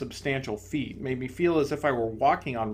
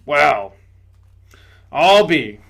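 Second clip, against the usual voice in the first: loud, gruff ghost exclaiming; indignant big ghost; 12 dB louder, slower than usual. Acted. Big ghost to the bright spirit, loud indignation. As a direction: blurt it out loudly, amazed and offended.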